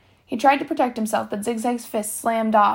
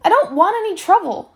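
higher, louder plea to avoid a fight; raised-pitch appeasing; anxious protest; 9 semitones higher, 6 dB louder than usual. A narrator's reading. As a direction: protest your way out of it. Boy backing away from a fight.

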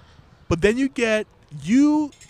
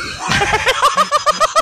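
loud laugh; 4 dB louder than usual. Real, not acted. Bursts out laughing mid-sentence.

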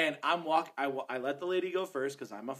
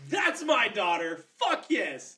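loud, higher triumphant shout; proud cheer; 5 semitones higher, 6 dB louder, slower than usual. Retold or imitated. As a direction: crow over your kid, loud and slow. Acting out his proud reaction.

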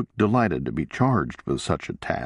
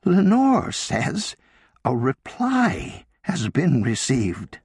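slow, higher, hardly believing her own words; disbelieving; 6 semitones higher, slower than usual. A narrator's reading. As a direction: say it slowly as if it cannot be true. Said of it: "sounding as though she couldn't believe".

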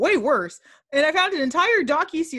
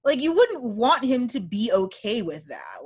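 ordinary talk

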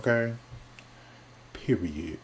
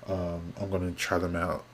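ordinary talk, nothing marked

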